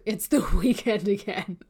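breaking into a real laugh mid-phrase; amused, words broken up by laughter. Real, not acted. Coach telling entrepreneurs how weeks slip away, laughing. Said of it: "listen, I'm laughing".

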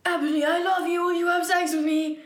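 rushed, high lovesick blurt; words run together. Acted. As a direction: rattle it out as if there are no spaces. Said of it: "There's no spaces in between the words".

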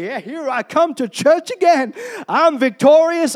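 pumped-up, victorious shout of faith; triumphant churchgoer. Acted. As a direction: proclaim it like a winner arriving. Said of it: "when we walk into church".

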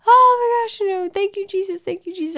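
high, cracking cry of thanks, tearful; retold tearful relief. Woman thanking Jesus in court after hearing her sentence, high tearful cry. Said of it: "I'm crying".

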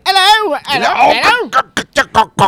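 high, loud, garbled English "hello"; mock English accent. Contrasting the nice English accents with the awful one, mock English accent. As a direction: squawk out a cartoonish English greeting. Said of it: "And then there is the".

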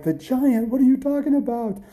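high, loud disbelief; incredulous. A narrator's reading. Mom hearing her son talk of a giant, incredulous. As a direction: question it as if it's nonsense.

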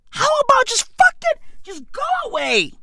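squeaky high mock plea to go away. Podcaster mockingly telling Chrissy Teigen to leave, squeaky high. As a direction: pitch it way up, mocking. Repeats demand in put-on voice.

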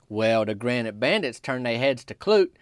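ordinary talk

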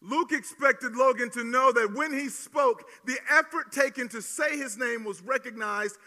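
loud, slow, emphatic preaching. Acted. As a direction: hammer each word home from the pulpit. Pastor driving home point.

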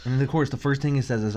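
usual speaking voice

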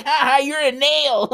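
high, drawn-out taunt with laughter; jeering mockery. Family shitting on him all the time, mocking jeer. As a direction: jeer like a hammed-up bully.

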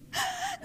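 a short squeaky giggle; high giggle. Real, not acted. Preacher giggling after teasing about partners, high giggle. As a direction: giggle at your own joke. Laughs after jowa joke.

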